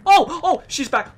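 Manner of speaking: high panicked yelp; jolted by the monster coming back; startled fright. Real, not acted. Gamer startled as the monster returns, panicked yelp. Horror game monster appears.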